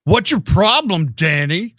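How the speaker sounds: high, slow teasing reproach; mock scolding. Co-host mock-scolding her in character.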